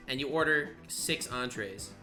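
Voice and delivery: usual speaking voice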